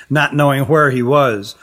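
plain reading voice

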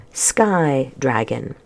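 ordinary delivery, nothing marked

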